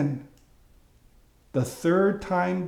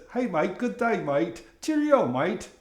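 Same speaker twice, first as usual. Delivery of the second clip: imitated Australian greeting, lilting; Australian accent; 3 semitones higher than usual. Friend from Australia greeting a mate, imitated Australian accent. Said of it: "she has a distinct Australian accent".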